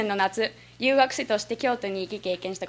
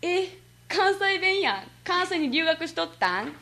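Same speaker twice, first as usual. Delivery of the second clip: higher-pitched Kansai exclamation and question; mildly acted surprise at someone's dialect; imitated surprised stranger; 6 semitones higher than usual. Japanese person meeting a returned exchange student, mock surprise. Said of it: "初めて会った日本人と話をするとすぐに".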